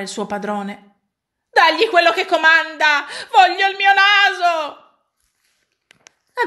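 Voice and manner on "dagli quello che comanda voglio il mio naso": high, loud command; desperate order. A narrator's reading. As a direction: demand the nose back at any cost. Master orders the maid. (ordine disperato, acuto e forte)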